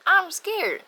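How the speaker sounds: high, loud frightened cry; reliving the fear, high and loud; scared. Retold or imitated. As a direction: squeak it out in fear. Retelling scary party night.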